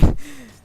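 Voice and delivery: короткий високий смішок; засмійся з обмовки; сміх. Real, not acted. (короткий високий смішок)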